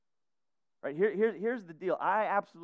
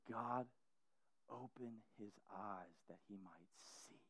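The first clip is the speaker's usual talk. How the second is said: much softer, almost breathed; hushed prayer; 8 semitones lower, 21 dB quieter than usual. Retold or imitated. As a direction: pray it low and intimate. Prophet's prayer retold.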